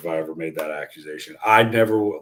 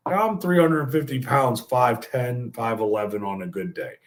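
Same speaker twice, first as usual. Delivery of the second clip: ordinary delivery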